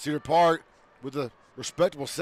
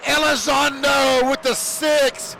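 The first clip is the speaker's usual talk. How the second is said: much higher, louder shout, slowing at the name; touchdown shout; 8 semitones higher, 3 dB louder, much slower than usual. Real, not acted. Announcer celebrating the home touchdown, excited shout. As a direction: belt out the touchdown and the scorer.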